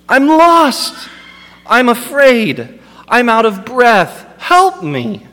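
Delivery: high, strained cry for help; desperate plea. Retold or imitated. Preacher voicing a sufferer begging Jesus, high and desperate. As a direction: plead like a man at his end. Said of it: "Things like".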